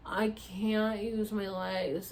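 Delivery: very slow, dragged-out words; helpless. Retold or imitated. Patient told to use her legs, slow helpless protest. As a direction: re-enact someone too out of it to move.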